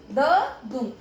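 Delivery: ददुम split into द, then दुम; syllable by syllable. Shown as a demonstration. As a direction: break ददुम into its two sounds. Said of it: "इसका sound कैसे निकल रहा है". (ददुम को दो टुकड़ों में अलग-अलग)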